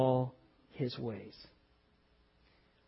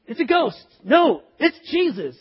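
shouted, much higher and louder; excited shout; 9 semitones higher, 10 dB louder than usual. Acted. Acting out fishermen crying out in a storm, loud. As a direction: call out in alarm, then relief.

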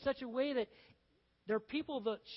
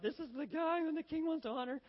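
mock yelling out; 6 semitones higher than usual. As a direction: imitate a herald calling out. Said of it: "yelling out".

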